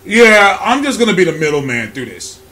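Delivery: low, matter-of-fact cop voice; imitated cop, pitched well down; police officer. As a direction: play the deep-voiced officer staying out of it. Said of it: "the Popo comes up to me, and he's like".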